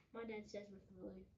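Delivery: usual speaking voice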